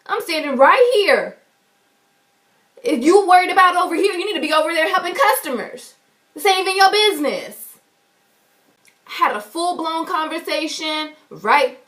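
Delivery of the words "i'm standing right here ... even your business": high, loud, fast telling-off; re-enacting telling off a bank clerk, high and fast; angry retort. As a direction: snap back at the nosy clerk, higher and faster. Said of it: "I'm like".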